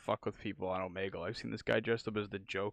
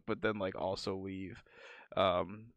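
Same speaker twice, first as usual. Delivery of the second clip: ordinary talk, nothing marked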